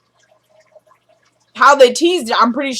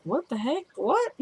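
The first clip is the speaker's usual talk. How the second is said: rising, much higher incredulous what; shocked disbelief; 4 semitones higher, 10 dB quieter than usual. Retold or imitated. Benny overhearing the call in the background, shocked disbelief. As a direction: act stunned, pitch climbing. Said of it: "you have Benny in the background like".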